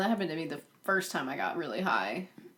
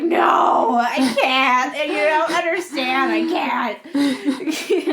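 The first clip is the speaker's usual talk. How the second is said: loud, higher, laughing half-wail; near tears; 7 semitones higher, 8 dB louder, much slower than usual. Retold or imitated. Refusing to drive friends home, loud laughing plea. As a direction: plead loudly, laughing at herself. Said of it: "I go up nearly in tears".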